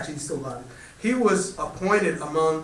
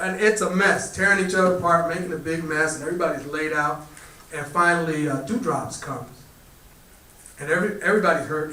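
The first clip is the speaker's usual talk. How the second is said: ordinary talk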